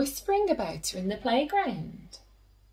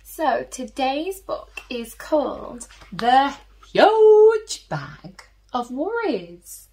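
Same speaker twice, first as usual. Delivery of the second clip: ordinary delivery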